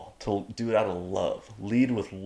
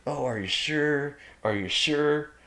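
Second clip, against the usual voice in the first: higher, hesitant mock questioning; tentative, higher-voiced imitation of pushback; unemphatic doubting; 5 semitones higher than usual. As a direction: play a wishy-washy doubter. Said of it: "a little bit more emphasis behind that no, rather than a".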